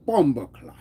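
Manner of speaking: mock Jamaican exclamation; Jamaican accent. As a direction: put on a playful Jamaican accent. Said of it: "if we do Jamaican".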